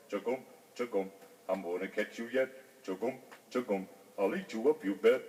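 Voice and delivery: put-on old frog. A narrator's reading. Frog threatening to eat the fly, low croak.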